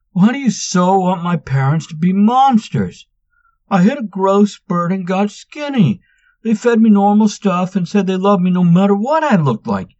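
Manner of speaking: loud, high, exasperated rant; angry outburst. A narrator's reading. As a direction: vent all the frustration. Manx exploding at the teasing.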